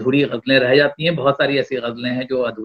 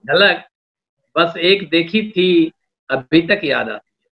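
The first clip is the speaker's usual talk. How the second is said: poetry recitation. Acted. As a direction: lean into each line of verse. Poet recites a couplet.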